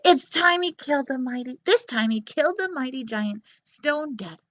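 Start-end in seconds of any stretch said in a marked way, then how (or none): none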